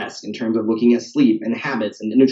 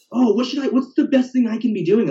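much higher mock-eager voice; voice imitation of passengers; 9 semitones higher than usual. Passenger seeking fitness tips from the driver, high imitation voice. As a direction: play the passenger high and questioning. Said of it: "why I have the voice imitations".

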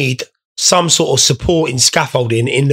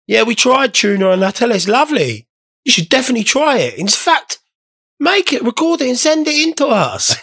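mock sincere pitch; 6 semitones higher than usual. Joking fake recommendation to viewers.

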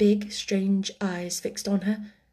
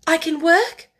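high, brightening realisation; relieved child; 8 semitones higher, 6 dB louder than usual. A narrator's reading. Orphan learns she may work, relieved. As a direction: lift it with dawning hope. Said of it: "a shade of relief".

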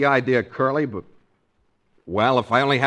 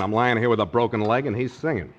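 ordinary talk

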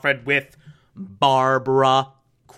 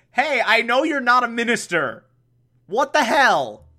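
higher-pitched accusing outburst; mock indignation; 6 semitones higher than usual. Batgirl confronts Alfred about the minister cover, mock outrage. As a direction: imitate an affronted confrontation.

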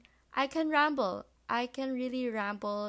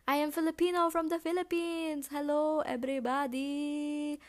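put-on playful Filipino accent, pitched high and louder; 7 semitones higher, 5 dB louder than usual. Podcast host greets listeners as a Filipino, in a put-on Filipino accent. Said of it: "I did that accent".

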